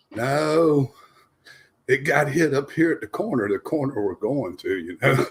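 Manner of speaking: ordinary talk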